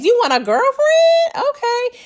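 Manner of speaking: high-pitched teasing question; mock surprise. Teasing Saxe about wanting a girlfriend, high mock surprise. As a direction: squeal it lightly in playful disbelief.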